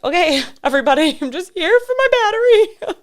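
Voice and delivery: high, loud, fast exasperated protest; retelling the Apple Store guards, high loud mock protest; mock exasperated self. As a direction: squeak it out, overwhelmed. Overwhelmed by store security guards.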